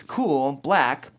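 usual speaking voice

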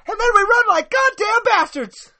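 loud, high shrieking, then an embarrassed apology; Rhonda's panicked line. Acted. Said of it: "and then Rhonda at the end".

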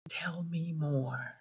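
soft, slow, breathy hush; gentle whisper. Shown as a demonstration. Parent to child coming out, a slow calm whisper. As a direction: calmly invite the child to go on. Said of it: "You take a deep breath".

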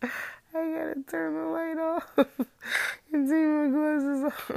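giggling through the words; laughing while talking. Real, not acted. Laughing while reaching the light.

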